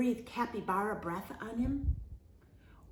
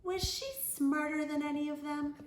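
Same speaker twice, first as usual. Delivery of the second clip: ordinary delivery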